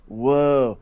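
imitated stoner, amazed and drawling. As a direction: put on a spaced-out stoner voice. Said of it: "like, a stoner voice".

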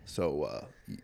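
very low, hushed mock-serious kid; imitated classmate. Comic imitating an astronomy classmate pitching a movie, low and hushed. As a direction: drop low and soft, self-important. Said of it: "and he's just like".

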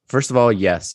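usual speaking voice

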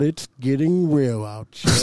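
usual speaking voice

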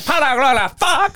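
put-on old man in terrible Spanish; mock bad Spanish. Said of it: "he goes like".

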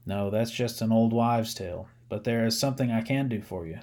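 plain reading voice

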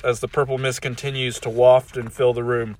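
plain reading voice

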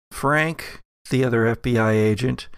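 normal talking voice

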